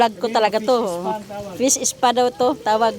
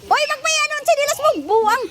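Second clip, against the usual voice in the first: scolding; 13 semitones higher than usual. Real, not acted. Scolding friends near the camera.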